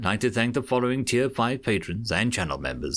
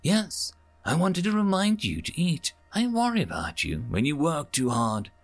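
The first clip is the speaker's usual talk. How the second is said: higher, fussing motherly voice; lightly voiced mother; 6 semitones higher than usual. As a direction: nag gently like a worried mother.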